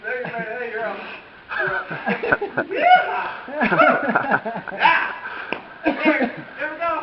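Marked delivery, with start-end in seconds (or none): 1.6-6.9 high laughing cheers; excited cheering. Real, not acted. Cheering friend down the slide.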